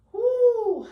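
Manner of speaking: high loud cheer of effort; excited whoop. Real, not acted. Cheer at end of set.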